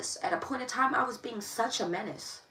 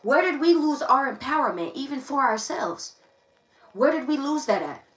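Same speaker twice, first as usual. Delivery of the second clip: raised, louder, pleading sermon voice; impassioned preaching; 4 semitones higher, 7 dB louder than usual. Acted. Streamer preaching to her viewers about lost self-esteem, impassioned. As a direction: preach it to the crowd.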